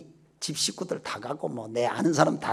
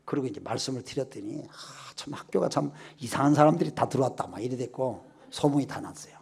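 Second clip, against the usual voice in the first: normal talking voice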